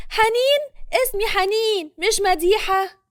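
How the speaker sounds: high, loud indignant correction. A narrator's reading. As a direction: insist on your name, irritated and loud. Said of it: "بتتعصب حنين وبتقول بصوت عالي". (بعصبية وبصوت عالي)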